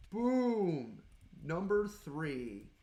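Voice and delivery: loud burst, then slow drawn-out words; triumphant shout. Real, not acted. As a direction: celebrate the drop loudly. Third skull drops.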